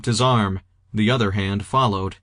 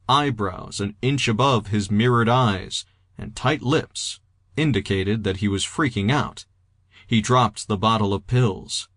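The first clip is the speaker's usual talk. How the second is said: ordinary delivery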